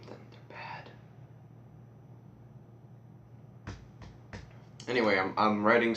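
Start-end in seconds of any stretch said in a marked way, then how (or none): 0.1-3.8 whispered aside. Real, not acted. Teen joking his books may be bad, whispered aside. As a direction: whisper the last word as a joke.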